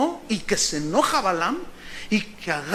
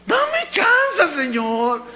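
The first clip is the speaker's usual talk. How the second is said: high, hammed-up plea; begging prophet; 8 semitones higher, much slower than usual. Balaam begging God for permission, high imploring mock wail.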